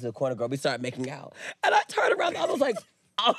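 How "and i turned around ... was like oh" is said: laughing. Real, not acted. Laughing, high.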